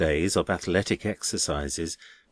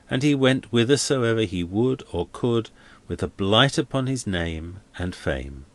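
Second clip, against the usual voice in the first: ordinary delivery, nothing marked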